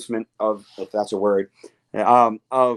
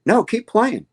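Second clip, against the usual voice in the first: higher and a bit louder, friendly insistence; much higher, friendly mock rock star; 10 semitones higher, 4 dB louder, slower than usual. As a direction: gently urge him to go on. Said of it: "He goes".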